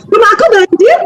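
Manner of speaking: high, gleeful announcement; cheerful telling. Retold or imitated. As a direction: chirp the bad news happily. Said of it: "Tapi dia gembira". (riang dan tinggi, seperti kabar gembira)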